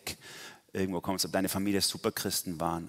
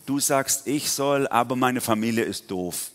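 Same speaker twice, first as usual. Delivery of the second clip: louder, mock protesting objection; hammed-up reluctant complaint; exasperated Gideon; 3 semitones higher, 5 dB louder than usual. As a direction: act out a reluctant man objecting yet again. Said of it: "zum x-ten Mal so".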